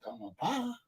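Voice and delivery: small, hushed acted answer; quiet reply. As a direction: say it meekly under your breath. Answer to mother, two contrasting takes.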